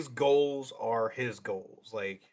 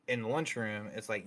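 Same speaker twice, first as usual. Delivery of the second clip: ordinary talk, nothing marked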